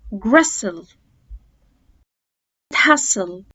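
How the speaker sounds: each word read slowly, t dropped; silent t examples. Shown as a demonstration. Teacher modelling words with the t silent. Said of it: "زي كلمة أباس،".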